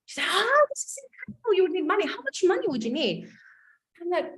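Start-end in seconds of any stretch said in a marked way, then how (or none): 0.3-3.3 high, bright-eyed coach voice; upbeat coach. Retold or imitated. Coach cheering on her client's idea, high and eager. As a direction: play the enthusiastic life coach.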